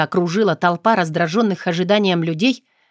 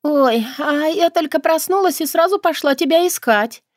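fake sleepy; 8 semitones higher than usual. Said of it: "старательно изображая сонное состояние".

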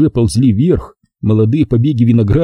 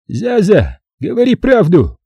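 high-pitched, voiced mock hiss; hissed demand; 10 semitones higher, slower than usual. A narrator's reading. Vakha orders his sister to tell the truth, mildly hissed. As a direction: demand it tensely in a high character voice. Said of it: "зашипел он на неё".

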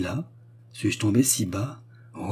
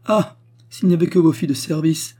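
high, loud anguished wail; distraught; 8 semitones higher, 6 dB louder than usual. A narrator's reading. Widow lamenting to her hostess, distraught. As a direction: wail it in exaggerated despair. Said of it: "se tordant les mains".

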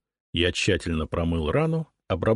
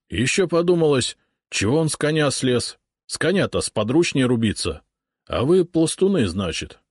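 higher, louder rustic cossack voice; folksy wounded urjadnik; 5 semitones higher, 3 dB louder than usual. A narrator's reading. Wounded urjadnik recounting the raid to the cossacks, rustic. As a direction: tell it like a shaken old soldier.

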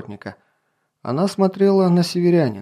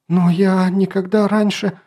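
higher, slow tearful cry; crying out through tears; 6 semitones higher than usual. A narrator's reading. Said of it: "вскричала принцесса сквозь слёзы".

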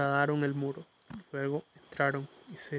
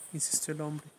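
plain reading voice

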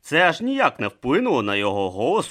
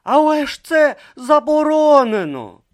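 exclaimed protest; 11 dB louder than usual. Acted. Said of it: "вигукнув Бруто".

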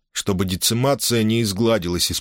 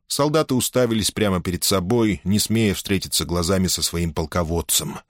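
usual speaking voice

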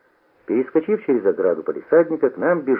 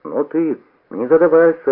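offended; 6 semitones higher, 5 dB louder than usual. A narrator's reading. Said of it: "обиженно возразил Бобыль".

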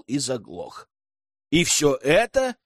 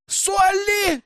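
frightened scream. A narrator's reading. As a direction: scream her name in fear. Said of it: "закричали испуганно подруги".

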